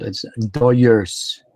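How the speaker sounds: slow, drawn-out "Dodgers" said as "Doyers"; Doyers pronunciation. Shown as a demonstration. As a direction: stretch "Dodgers" out with a y in the middle. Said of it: "You gotta say it right".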